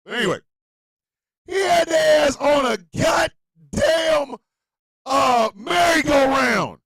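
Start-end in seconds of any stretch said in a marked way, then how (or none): 1.4-6.8 slow, loud emphatic yell; slow shout. Real, not acted. Streamer ranting at Trump voters, loud slow yell. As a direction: spell it out loudly, slowly. Outraged rant.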